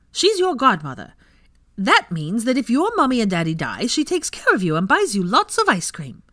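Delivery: put-on high, loud little boy voice; boy telling toddler what a godmother is; cheerful child explaining. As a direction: chirp it out like a know-it-all six-year-old.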